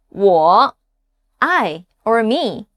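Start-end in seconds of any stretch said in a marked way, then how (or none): 0.1-0.7 "Wo," dipping low, then rising; low falling-rising "Wo,"; third tone. Shown as a demonstration. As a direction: sink low in "Wo," and curve back up. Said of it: "common words with the third tone".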